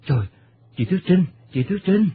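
panicked calling. Acted. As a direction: call out in sudden alarm. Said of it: "thảng thốt gọi". (gọi thảng thốt, hoảng hốt)

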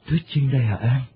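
voice cracking in shock. Acted. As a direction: blurt it out stunned, voice breaking. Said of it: "kêu lên lạc giọng". (kêu lên lạc giọng, sững sờ)

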